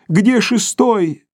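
stretched plaintive call. Acted. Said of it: "слабо крикнул он". (слабый протяжный крик)

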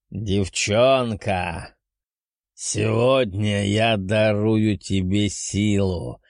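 slow, commanding ancient beast voice; solemn dragon. Acted. Dragon grants power.

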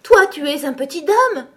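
high, loud alarmed cry. Acted. The fawn recoiling from Alice, loud and alarmed. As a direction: cry out in sudden fear.

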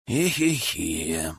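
long, drawn-out low sigh; heavy sigh. A narrator's reading. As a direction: sigh it out slowly on "ehe-he". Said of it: "громко вздохнул Максим".

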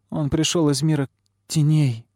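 high, tearful cry; mildly sobbing girl's lament. A narrator's reading. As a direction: cry it out through tears. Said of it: "рыдало пламя".

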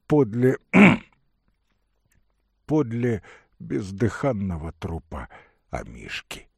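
ordinary delivery, nothing marked

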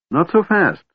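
high-pitched protest; firm objection. A narrator's reading. Dwarf holds back the eager kender, objecting.

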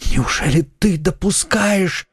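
anguished question, pitched high; high, tearful disbelief; breaking into sobs. A narrator's reading. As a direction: ask in desperate alarm, near tears. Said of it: "Голос его перешёл в рыдание".

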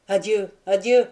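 a husband's final farewell cried up to his wife. A narrator's reading. Montlouis cries adieu to his wife at the window, loud. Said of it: "lui cria-t-il".

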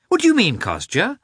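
high-pitched alarmed question; terrified. A narrator's reading. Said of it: "exclaimed Dolly, terrified".

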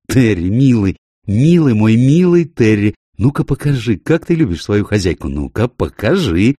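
high, loud cooing to a dog; playful, affectionate pet talk; doting excitement. Acted. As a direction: gush over your darling dog. Owner fusses over her dog.

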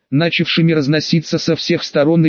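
usual speaking voice

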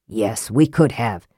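low, slow, smooth reply. A narrator's reading. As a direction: concede it smoothly, in no hurry. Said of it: "said Raistlin smoothly".

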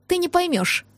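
mildly proud, lifted dismissal; proud declaration. A narrator's reading. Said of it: "гордо заявила я".

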